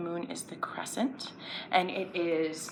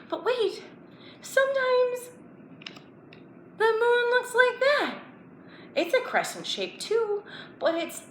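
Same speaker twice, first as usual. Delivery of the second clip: higher, playful wonder; mock surprise. Teacher surprises kids with another moon shape. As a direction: make them curious.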